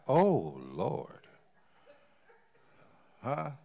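ordinary delivery

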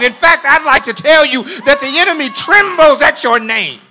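high, very loud sermon shout; shouted preaching climax. Real, not acted. Preacher assuring flock the enemy fears them, shouted climax. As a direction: shout it out to the whole church.

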